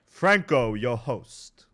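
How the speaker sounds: loud proud innkeeper. Acted. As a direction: announce yourself grandly. Franco introducing himself.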